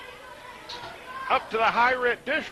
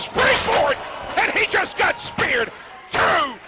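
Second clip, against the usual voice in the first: excited shout; 8 semitones higher, 4 dB louder, slower than usual. Acted. Spear call.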